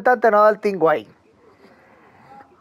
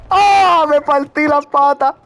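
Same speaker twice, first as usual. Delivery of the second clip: pained game yell; 6 semitones higher, slower than usual. Real, not acted. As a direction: cry out high as you land hard. Yells after hard landing in game. (grito agudo de caída)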